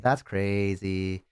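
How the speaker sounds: slow, drawn-out token reaction; imitated half-interested kid; feigned interest. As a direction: stretch it out, politely humoring them. Kid humoring divorce talk.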